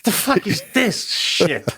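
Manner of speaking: slow, incredulous imitation of a scandalized onlooker; mock disgust. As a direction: play an appalled audience member, slow and high. Acted reaction of appalled audience.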